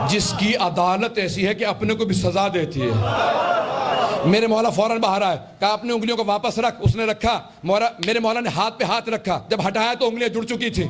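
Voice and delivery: high-pitched, driving sermon delivery; rousing preaching. Real, not acted. Preacher telling the crowd of the healed fingers, rousing preaching. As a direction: lift the pitch and carry the crowd along. Preacher raises the miracle story.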